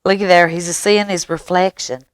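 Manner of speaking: usual speaking voice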